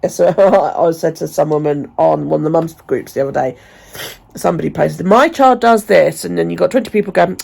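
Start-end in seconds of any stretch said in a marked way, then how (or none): 5.1-6.2 mock post. Mocking a post on mums group.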